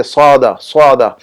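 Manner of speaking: سعادہ twice, with a heavy "swaa" opening; pronunciation demo. Shown as a demonstration. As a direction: model سعادہ as a separate falling word. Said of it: "یہ لفظ استعمال کرتے ہیں".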